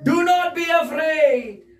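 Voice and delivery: high, slow, ringing declaration; scripture proclamation. Real, not acted. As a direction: proclaim the verse phrase by phrase.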